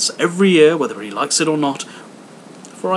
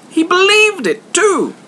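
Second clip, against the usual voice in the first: much higher and louder cry; gleeful cry; 8 dB louder than usual. A narrator's reading. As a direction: shriek it out in delight. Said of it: "cried Scrooge's nephew".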